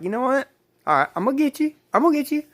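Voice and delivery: high-pitched vow of payback; retold defiant threat. Teen retelling a warning to a schoolmate on the bus, high defiant threat.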